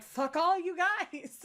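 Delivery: drawn-out yelled curse at YouTubers; screaming up at YouTubers, a slow mock yell. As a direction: shout it slowly into the void. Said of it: "screaming up at all these fucking YouTubers".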